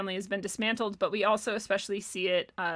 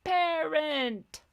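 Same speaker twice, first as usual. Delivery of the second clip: "Parent." said high and drawn out like a teacher's drill word; 6 semitones higher than usual. Shown as a demonstration. Vlogger drilling the audience on the word, sing-song. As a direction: lead the class in saying "Parent." slowly. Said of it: "Say it with me now".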